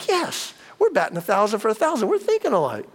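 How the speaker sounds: high-pitched, playful yes; eager agreement. Retold or imitated. Husband agreeing to lunch with his wife, playfully overeager.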